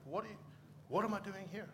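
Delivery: high-pitched, mildly helpless questioning; retold bewildered self-doubt. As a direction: relive feeling out of your depth. Retells feeling out of his depth.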